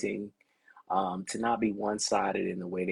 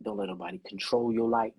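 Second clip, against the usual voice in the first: ordinary talk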